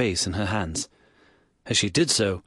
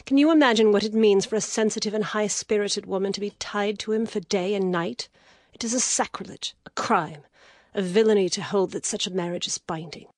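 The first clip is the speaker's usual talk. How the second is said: rising anger; 11 semitones higher than usual. Acted. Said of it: "the wild anger died away".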